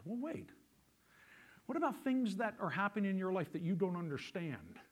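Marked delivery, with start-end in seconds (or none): none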